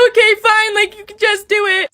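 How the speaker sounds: high, loud, crying surrender; patient reluctantly agreeing to the exam, sobbing; tearful giving in. Retold or imitated. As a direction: give up through tears. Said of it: "I'm crying so loud".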